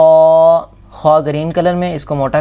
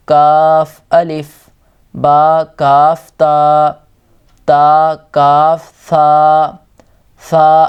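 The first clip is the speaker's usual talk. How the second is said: slow recitation; 4 dB louder, slower than usual. Shown as a demonstration. Teacher drills letter names.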